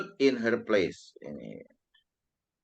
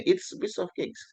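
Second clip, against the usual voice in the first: breezy, higher pitched brag; casual thumbs-up boast; 11 semitones higher, 3 dB quieter than usual. Shown as a demonstration. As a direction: toss it off like it's nothing. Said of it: "Biasanya tangan jempol".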